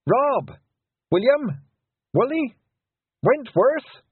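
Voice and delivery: high, loud calls for lost friends; desperate shouting. A narrator's reading. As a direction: shout each name into the distance. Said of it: "She just needed to shout".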